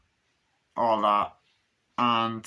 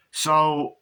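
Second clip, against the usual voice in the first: normal talking voice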